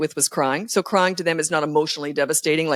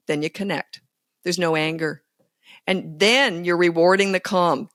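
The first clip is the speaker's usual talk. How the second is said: normal talking voice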